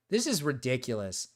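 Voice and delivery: ordinary talk, nothing marked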